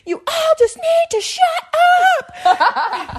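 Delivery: very high, drawn-out imitation scream; slow shriek, stretched to the last word; mock scream. Mom finally screaming at the whole family, high mock shriek.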